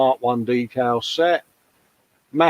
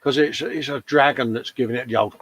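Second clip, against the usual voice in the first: usual speaking voice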